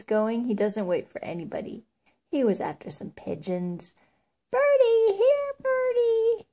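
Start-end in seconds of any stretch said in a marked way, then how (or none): 4.5-6.5 very high, drawn-out call; little boy calling. A narrator's reading. Little boy chasing pigeons, high slow calling. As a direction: call the birds in a high, stretched child's voice. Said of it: "he called".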